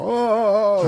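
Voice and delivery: high-pitched wail of dismay; comic, hammed-up moan of panic; mock dread. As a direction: react to a terrible order with a drawn-out high "oh". Retold dread at the command.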